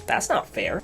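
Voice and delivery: ordinary talk, nothing marked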